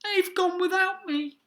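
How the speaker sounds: high, mildly wailed complaint; plaintive cry. A narrator's reading. Drip left behind by his family, plaintive cry. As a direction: lament it in a high little voice. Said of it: "And he cried".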